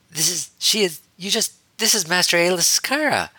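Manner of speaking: high, loud, stumbling outburst; indignant spluttering. A narrator's reading. Ahsoka to Harry about the Jedi Master, spluttering. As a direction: splutter it out, scandalised. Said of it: "Ahsoka spluttered".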